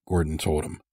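usual speaking voice

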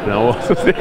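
low demonstration of a deep voice; put-on deep voice. Shown as a demonstration. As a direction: drop into a low register. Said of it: "una voz así profunda".

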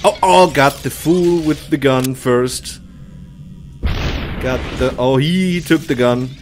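ordinary delivery

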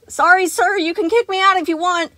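high, loud nervous offer; called-back apology. A narrator's reading. Gregory calling back to Sun, high and loud. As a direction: shout back while running. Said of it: "Gregory called back".

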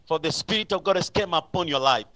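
ordinary delivery

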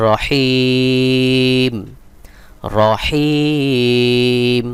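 রহিম with a very long held হি, closing on m; slow, sustained chant of রহিম, stretching the i; three-alif stretch. Shown as a demonstration. Teacher demonstrates correct ending to learners, রহিম hugely drawn out. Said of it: "তিন আলিফ টান দিয়ে পড়তে হবে".

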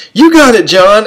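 imitated high, upbeat cheer; encouraging cheer. Artist to student about to squeeze the sponge, high mock cheer. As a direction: call it out high, cheering him on.

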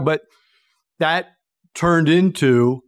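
ordinary talk

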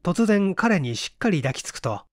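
ordinary delivery

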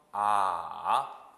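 "아" dipping low, then rising; third tone. Shown as a demonstration. Teacher modelling the third tone, low dip then rise. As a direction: scoop "아" down and swing it back up. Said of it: "삼 성".

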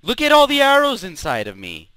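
high, loud cry of disbelief; loud exclamation. Real, not acted. Gamer sees the arrows stuck in them, loud exclaiming. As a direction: yell it out in exasperated amazement.

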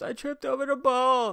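mock tearful child explaining; injured girl telling counselors she tripped, high whiny mock; hurt little girl. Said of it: "She goes".

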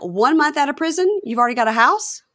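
can't believe the man's got a house already; incredulous. Retold or imitated. Friend recapping a reality show, voicing disbelief. Incredulous reaction to prison release.

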